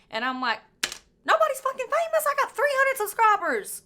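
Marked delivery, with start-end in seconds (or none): 1.3-3.8 much higher, incredulous squeal; exasperated retort. Retold or imitated. Answering hometown people who call her famous, high and incredulous. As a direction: act appalled that anyone calls her famous.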